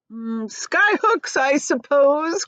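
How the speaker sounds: slow mocking drawl; jeering. A narrator's reading. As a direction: tease him, dragging the words out. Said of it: "jeered the centipede".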